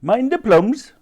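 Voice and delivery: high-pitched market trader warning; stallholder's shout. A narrator's reading. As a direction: call out over the barrow, moderately loud. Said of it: "shouted one".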